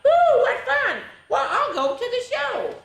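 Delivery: very high, sung-out lazy bird voice; high chirpy Maisie sings out; flighty bird sing-song. Acted. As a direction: call out gaily in a high bird voice. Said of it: "she sang out".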